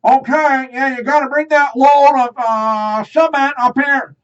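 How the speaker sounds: very high, loud walkie-talkie order; loud worker on phone. Retold or imitated. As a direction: bark it like a worker on a radio. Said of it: "it's like".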